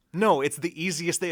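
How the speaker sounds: firm mock correction to mom; mock exasperated. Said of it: "I'm like".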